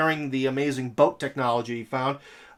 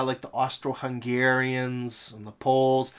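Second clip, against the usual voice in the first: usual speaking voice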